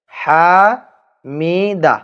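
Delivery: হামিদা stretched very slowly, each syllable held; drawn-out recitation. Shown as a demonstration. Teacher slowly intoning হামিদা for the class. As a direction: chant the word হামিদা long and slow. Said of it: "হামিদা শব্দটি হচ্ছে".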